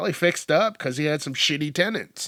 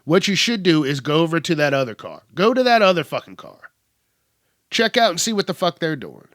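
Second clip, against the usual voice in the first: louder and higher, telling the cop off; rising frustration, hammer it home; exasperated rant; 4 semitones higher, 5 dB louder than usual. Retold or imitated. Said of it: "And I'm just like".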